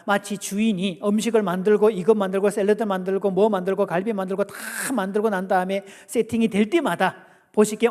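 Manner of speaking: normal talking voice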